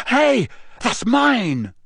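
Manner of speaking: high, slow, startled protest; surprised boy. A narrator's reading. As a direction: blurt it out in surprise. Harry recognizing his wand.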